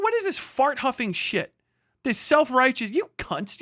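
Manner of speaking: usual speaking voice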